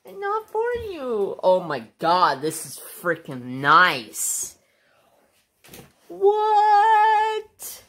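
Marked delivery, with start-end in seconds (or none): 0.0-1.4 high sing-song voice for the dog; talking to the dog. Real, not acted. Telling the dog Bobo off. 6.1-7.8 high sung-out what; real delighted surprise; amazed what. Real, not acted. Amazed at the sweatshirt.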